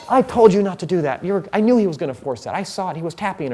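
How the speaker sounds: hammed-up spectator scolding a buddy; mock accusing shout. Spectator scolds a friend for giving the trick away, mock accusing shout. Spectators blame each other.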